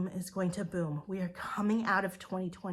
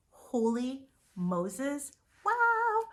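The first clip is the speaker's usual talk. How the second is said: a drawn-out holy Moses bursting into a much higher, louder wow; amazed exclamation; 6 semitones higher, 3 dB louder, much slower than usual. Real, not acted. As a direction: let the amazement explode on wow. Amazed by the business results.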